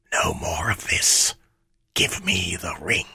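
hushed menacing shadow; dark whisper behind you. Acted. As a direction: breathe it out like a shade. Shadow Ammit speaks.

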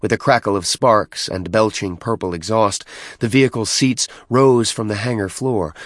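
ordinary delivery, nothing marked

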